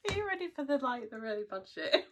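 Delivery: laughing through the question; giggling. Real, not acted. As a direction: giggle as you tease it. Giggling teaser before confession.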